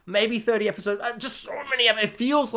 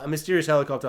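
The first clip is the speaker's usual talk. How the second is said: ordinary delivery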